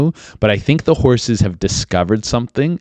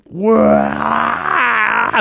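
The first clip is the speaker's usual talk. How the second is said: mock wail; 13 semitones higher than usual. Imitating someone plunging to death, high mock wail. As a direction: wail like you're falling.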